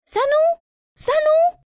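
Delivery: very high, loud rising calls; shrill shout. A narrator's reading. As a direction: shriek the name. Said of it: "shouts the aunties".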